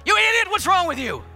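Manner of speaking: high, loud furious yell; road rage yell. Retold or imitated. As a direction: scream at the idiot driver.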